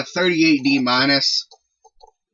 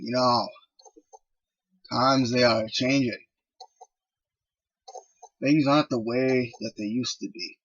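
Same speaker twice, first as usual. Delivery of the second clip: Raven. Acted. Wrestler musing on his place in a changing world, cryptic and subdued.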